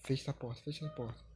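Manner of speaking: quiet urgent plea; hushed urgency. Real, not acted. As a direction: keep it down while hiding. Hiding from the chaser.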